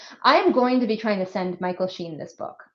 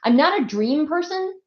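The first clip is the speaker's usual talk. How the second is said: usual speaking voice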